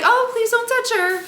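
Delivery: very high, acted polite plea; high-pitched plea. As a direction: plead in a put-on high voice. Imagined plea to the attendant.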